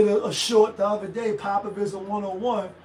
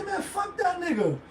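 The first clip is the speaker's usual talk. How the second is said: casual dismissal; 8 semitones higher, 4 dB quieter than usual. Retold or imitated. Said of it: "you don't mean it with no vitriol".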